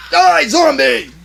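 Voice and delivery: slow, loud, high battle cry. Retold or imitated. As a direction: shout it like a warrior striking. Character kills zombie.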